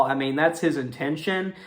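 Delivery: ordinary talk, nothing marked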